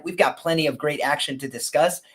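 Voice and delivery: ordinary talk, nothing marked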